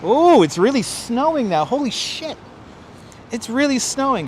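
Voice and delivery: excited. Real, not acted. Sudden heavy snow outside.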